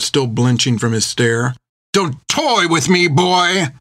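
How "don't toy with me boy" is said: higher-pitched, snapping warning; angry snap. A narrator's reading. Creech warns Finn, angry. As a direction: snap it out in anger.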